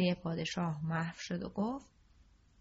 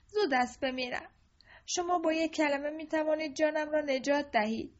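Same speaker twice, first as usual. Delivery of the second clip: put-on lightly raised boyish voice begging for his life; a scared pauper boy pleading; boy begging the king to spare him; 8 semitones higher, 3 dB louder than usual.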